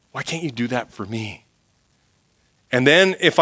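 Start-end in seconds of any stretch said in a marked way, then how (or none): 0.1-1.5 much softer, almost to himself; hushed plea. Retold or imitated. Preacher echoing his own plea to God, much softer. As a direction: let the plea sink to near silence.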